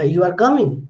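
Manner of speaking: "You are coming" with pitch swung up on coming; rising intonation. Shown as a demonstration. Teacher demonstrating rising intonation, "You are coming" asked with a lift on coming. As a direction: make "You are coming" a question by lifting "coming". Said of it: "rising intonation".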